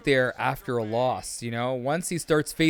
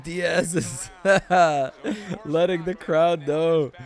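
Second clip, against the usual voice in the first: words stretched round a laugh; slow down and chuckle through the call; amused laughing; 3 semitones higher, slower than usual. Real, not acted. Commentator amused as Diaz plays to the crowd, laughing.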